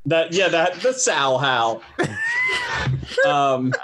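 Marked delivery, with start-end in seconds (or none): 1.9-3.2 high, cackling yip of a laugh; hyena laugh. Pretend. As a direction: let out a wild hyena cackle. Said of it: "You're doing the hyena laugh?".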